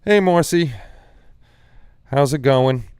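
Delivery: loud, high, bright hello; excited greeting. Real, not acted. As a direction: call out a cheerful hi. Greeting a viewer in chat.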